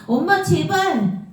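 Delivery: high, slow pleading; pleading cry. Acted. Boy begging his mother at the door, high slow plea.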